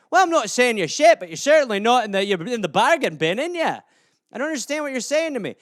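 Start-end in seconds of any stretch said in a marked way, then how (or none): 0.1-3.8 hammed-up Scottish lilt; Scottish accent. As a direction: play a chatty Scot, pitched up. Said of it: "some Scottish euphemisms for you".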